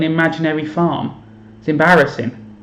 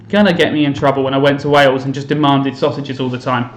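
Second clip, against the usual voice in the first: ordinary talk, nothing marked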